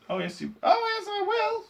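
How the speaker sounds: put-on tiny, very high boy voice; piercing little mouse voice; squeaked. A narrator's reading. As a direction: squeak defiantly. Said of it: "squeaked the tiny voice of Mike T V".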